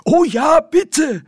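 very high, loud, overjoyed; eager. Acted. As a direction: shout it out, thrilled and pleading. Windle eagerly answers the offer.

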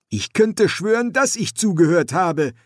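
put-on high, eager young man; young son voice. Son's voice in story.

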